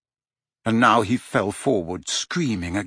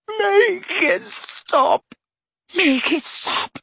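very high, very slow anguished moan; much slower than usual. A narrator's reading. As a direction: drag it out in pain. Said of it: "moaned Dumbledore".

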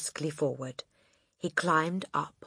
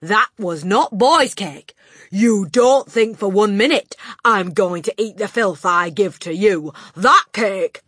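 loud, relentless berating; furious tirade; 5 semitones higher, 12 dB louder than usual. Acted. Headmistress berating the boy about her cake. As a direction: rant at him without pause.

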